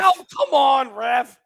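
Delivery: high shout at the ref; yelling from the sidelines. Real, not acted. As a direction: yell at the referee. Yelling at the referee.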